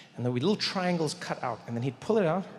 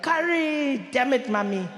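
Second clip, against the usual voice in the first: light imitation of his scream; 8 semitones higher, slower than usual. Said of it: "you're like".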